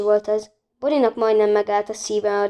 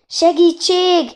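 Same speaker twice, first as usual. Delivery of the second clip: high, loud, drawn-out cry; cry for help; 9 semitones higher, 6 dB louder, much slower than usual. A narrator's reading. A woman calling the hotel for rescue, high shouted cry for help. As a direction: call out for help, stretching the word. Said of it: "harsant fel egy kiáltás".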